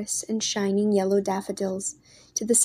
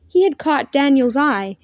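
plain reading voice

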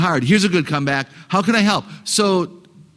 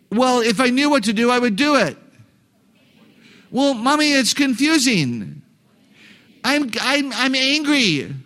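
put-on high-pitched child protesting; complaining kid; 7 semitones higher than usual. Kid stalling on schoolwork to a parent, high complaining voice. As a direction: whine it higher, like a stuck child. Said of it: "I'm gonna be the kid".

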